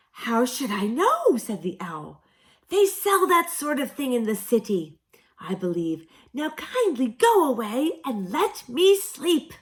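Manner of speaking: put-on high, loud, scratchy old owl. A narrator's reading. Owl woken at midday, loud and scratchy. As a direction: croak it out like a deaf old owl.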